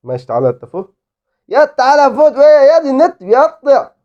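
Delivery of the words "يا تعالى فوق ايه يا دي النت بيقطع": high, loud exasperation; frustrated outburst. Real, not acted. Gamer fuming as the internet cuts out. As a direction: complain sharply at the lag.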